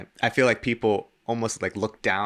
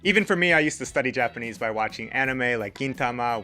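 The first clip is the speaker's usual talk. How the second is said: upbeat, polished ad read over music; bright, energetic read of a sponsor spot; 6 semitones higher, 4 dB louder than usual. Acted. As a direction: sell the product cheerfully.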